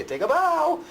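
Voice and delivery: bright sing-song cue; demo cue. Shown as a demonstration. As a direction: show how to cue the bow. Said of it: "what you do is you say".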